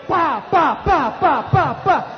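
loud imitated bangs; popping blasts. Preacher acting out the exploding oven, loud popping. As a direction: mimic the oven going off in bursts.

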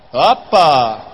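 loud, hammed-up exclamation; mock astonishment. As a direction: blurt it out as if caught by the sight. Mimics the peeker's startled reaction. (asombro exagerado, en voz alta)

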